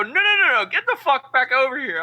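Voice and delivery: high, loud call back; loud, high pleading to stay; calling him back. Retold or imitated. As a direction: yell him back into the room. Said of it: "and I go".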